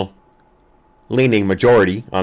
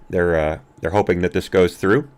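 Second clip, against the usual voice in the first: usual speaking voice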